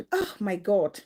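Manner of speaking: real dismay. Real, not acted. Host reacts to a story of intimidation, real dismay.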